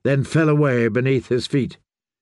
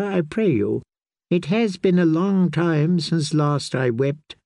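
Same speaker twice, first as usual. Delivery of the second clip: an old scholar savoring the rain, a hint of sorrow; old maester voice; 4 semitones higher than usual. A narrator's reading. Narrator voices old blind maester.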